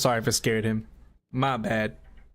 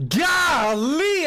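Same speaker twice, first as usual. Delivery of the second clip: surprised; 13 semitones higher, 3 dB louder than usual. Real, not acted. Flustered exclamation, high.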